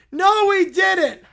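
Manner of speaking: loud, slow, indignant cry; child shouting back at the praise; furious shout. Acted. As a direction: yell the denial like a fed-up child. Kid shouting contradiction.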